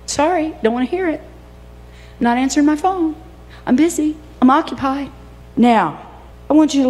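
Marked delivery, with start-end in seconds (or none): 0.1-5.1 higher-pitched, breezy dismissal; mock brush-off. Bride-to-be turning callers away, playfully dismissive. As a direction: brush them off in short, separate phrases.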